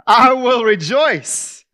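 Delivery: loud, high, slow declaration; preaching Paul's vow at full force; triumphant proclamation. Acted. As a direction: ring it out with full conviction. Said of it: "where he says".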